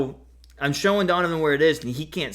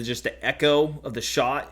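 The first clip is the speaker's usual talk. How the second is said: ordinary talk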